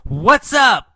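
high, loud call across the street; yelled greeting. Acted. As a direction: yell it out to her. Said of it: "he yells to her".

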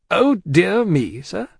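slow, high, indignant repeat; taken aback, drawn out and forceful; affronted echo. A narrator's reading. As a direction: throw it back as an offended challenge. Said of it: "repeated Stryver, drawing back".

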